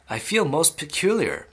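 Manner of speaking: usual speaking voice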